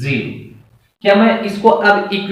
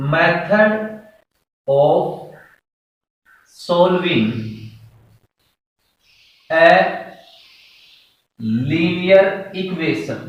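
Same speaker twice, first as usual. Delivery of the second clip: each word alone, long pauses between; word-by-word dictation; 2 semitones lower, much slower than usual. Shown as a demonstration. Teacher dictating the topic title to students, long pauses between words. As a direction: dictate it slowly for students to copy. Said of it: "next topic".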